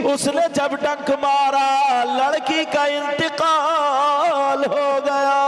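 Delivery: high, loud preaching at full force; fiery sermon. Acted. Preacher warning the girls in full voice. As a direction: drive the tale home like a preacher.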